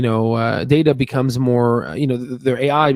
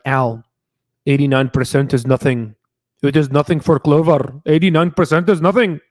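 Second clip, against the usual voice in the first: imitated investor in an Indian accent. Poor retail investor complaining to Al, in an Indian accent. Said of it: "I don't know why I did an Indian accent".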